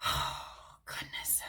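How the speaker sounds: soft whispered exclamation; whispered sigh of relief. Real, not acted. Reader whispers, moved by a wave of relief. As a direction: let it out in a hush, moved.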